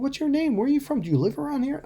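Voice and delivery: gushing questions in a lightly put-on girly voice; eager young girl. Retelling how the teen girl chatted him up, in a high mock-girly voice. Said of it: "the girl was just going".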